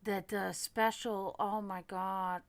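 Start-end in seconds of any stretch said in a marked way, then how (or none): none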